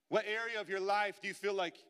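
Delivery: loud, high impassioned sermon; full-force preaching. Acted. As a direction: preach it out to the whole room.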